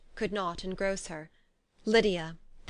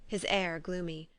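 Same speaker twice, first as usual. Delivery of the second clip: ordinary delivery, nothing marked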